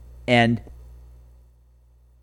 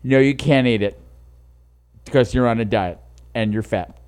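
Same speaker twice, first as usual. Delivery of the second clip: pet talk; 7 semitones lower than usual. Real, not acted. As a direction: coo at the cat, teasing. Talking down to the pet cat.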